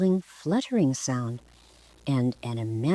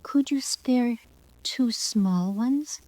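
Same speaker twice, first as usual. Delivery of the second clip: high, slow and sweetly coaxing; soft sweet voice; 9 semitones higher, slower than usual. Acted. Girl sweetly begging the peacock for plumes, soft and slow. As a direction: coax him gently. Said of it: "in a very soft, sweet voice".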